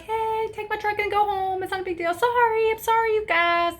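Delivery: put-on a carefree drunk waving them off; high giddy apologetic drunk; drunk friend.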